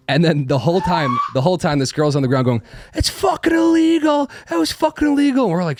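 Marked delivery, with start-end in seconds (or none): 2.9-5.5 high, loud indignant shriek, retold in fun; mock outraged yell. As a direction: shriek it up from the floor like a wronged teen. Girl yelling from the ground.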